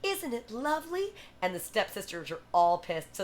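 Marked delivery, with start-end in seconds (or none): 0.0-1.1 high, lilting princess imitation; high-pitched storybook heroine; voiced Cinderella. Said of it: "she comes downstairs and she goes".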